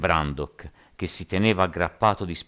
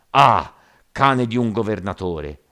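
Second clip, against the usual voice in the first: loud, raised-pitch angry cry; bellowed insult; 5 semitones higher, 10 dB louder than usual. A narrator's reading. Giant rioter insulting the governor, bellowing. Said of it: "vociò il gigante".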